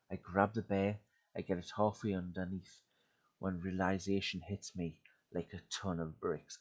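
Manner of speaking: tense, dramatic horror story narration. A narrator's reading. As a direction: read it like a creepy campfire tale. Reads horror story aloud.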